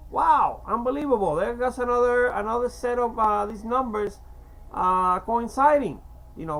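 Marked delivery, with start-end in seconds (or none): 0.1-3.6 loud, high exclamation of disbelief; amazed. Real, not acted. Speaker struck by numbers coinciding, astonished. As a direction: let out a big wow of astonishment.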